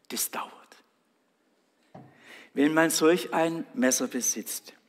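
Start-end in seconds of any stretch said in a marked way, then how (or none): 0.1-0.5 hushed echo. Shown as a demonstration.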